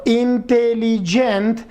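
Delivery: "intelligent" said very slowly, rising; slow example word. Shown as a demonstration. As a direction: stretch "intelligent" out for the class. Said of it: "Por ejemplo". ("intelligent" pronunciado muy despacio)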